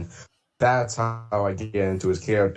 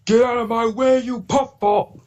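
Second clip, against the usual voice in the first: much higher, snarling villain shout, drawn out slowly; high, slow, hammed-up villain yell; Diesel 10 Neil Chrome voice; 13 semitones higher, 3 dB louder than usual. Shown as a demonstration. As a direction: bark it like the cartoon villain engine. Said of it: "that's Neil Chrome".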